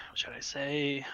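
unvoiced whisper to the table; whispered aside. Real, not acted. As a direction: ask it in a hush. Asking table quietly.

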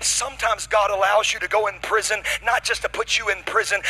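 fired-up sermon delivery; full-force preaching. Real, not acted. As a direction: drive it relentlessly up to a shouted climax.

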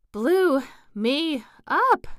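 breathless, high and loud, dragged slowly; a winded queen panting hard, high and loud. A narrator's reading. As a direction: gasp it out, still winded. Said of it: "panted the queen".

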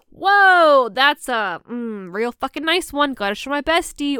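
high, loud mock admiration over a photo; mock impressed. Showing off a dick pic to her bestie, mock delight. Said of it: "Or it's like".

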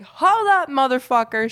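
loud, high retold yell of Aunt Mickey; mock yell. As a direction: bark it out as tipsy Aunt Mickey. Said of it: "and she's like".

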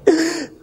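loud, high acted gasp; gasp like surfacing from drowning. Man re-enacting waking after being touched by God, drowning gasp. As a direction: gasp as if just pulled from water. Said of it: "bangun kayak orang habis kelelep dari air".

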